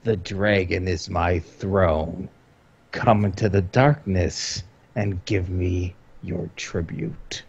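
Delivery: slow, low monster growl; deep monster voice, fading to a whisper. Acted.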